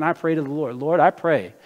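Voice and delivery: normal talking voice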